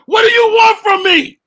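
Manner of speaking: high, loud plea; acted shout. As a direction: cry out at him in distress. Girl's line acted in story.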